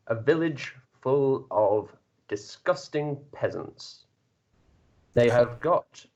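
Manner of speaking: normal talking voice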